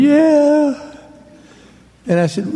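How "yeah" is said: a high, small, sheepish yes; a weak high-pitched yeah from a cornered dad; meek beaten dad. Retold or imitated. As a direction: answer like a humbled father, pitched way up. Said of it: "And poor Dad going".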